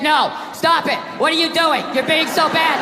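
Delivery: matter-of-fact courtroom reading; straight, unsexy recital to a judge; bad read. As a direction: read the protest out with none of the playfulness. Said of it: "you get a bad read".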